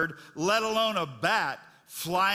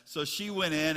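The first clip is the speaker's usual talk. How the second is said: normal talking voice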